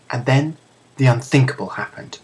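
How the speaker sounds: ordinary delivery, nothing marked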